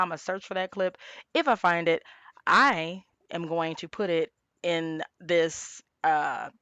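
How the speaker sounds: ordinary talk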